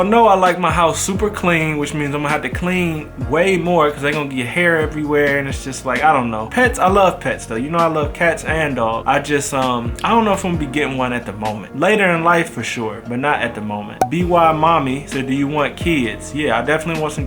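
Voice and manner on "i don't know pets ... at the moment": ordinary delivery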